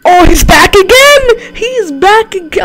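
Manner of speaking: high, loud, slow mock alarm; dramatic announcement. Real, not acted. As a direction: announce his return like a movie. Phantom Freddy returns.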